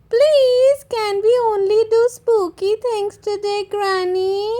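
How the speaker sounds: put-on high eager little-girl plea; bright child Peppa. A narrator's reading. Little girl pleading with her granny, high and eager. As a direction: beg Granny like an excited small child.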